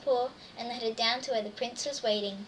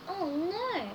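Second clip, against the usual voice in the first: dismayed; 5 semitones higher, 4 dB quieter than usual. Acted. Reader gasps, slipper left.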